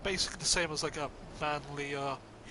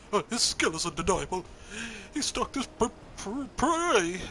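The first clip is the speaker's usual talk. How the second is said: trembling whiny yelps, higher; Cowardly Lion voice; 4 semitones higher, 4 dB louder than usual. Acted. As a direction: whimper it like a scared lion. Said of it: "giving him the Cowardly Lion voice".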